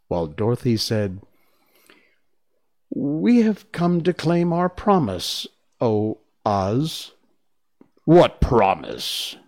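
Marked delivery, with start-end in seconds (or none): 2.9-7.1 put-on girl voice. Dorothy claims the promise. 8.0-9.3 booming wizard voice. Acted. The hidden Oz booms.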